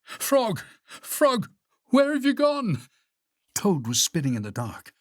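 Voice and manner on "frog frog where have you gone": high, distressed calling; high-pitched frightened search; panicked cry. A narrator's reading. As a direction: call desperately for a lost friend. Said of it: "cried Toad".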